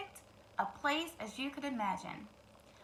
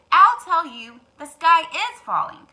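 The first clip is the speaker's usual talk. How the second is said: loud insistent hen; 4 semitones higher, 15 dB louder than usual. Acted. Hen insists the sky is falling. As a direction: hammer the point home.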